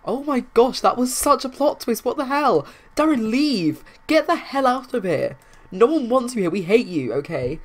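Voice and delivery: high, loud and fed up; furious rant. Real, not acted. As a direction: chase the pest out with real anger. Ranting at hated sim Darren.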